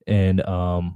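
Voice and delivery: ordinary delivery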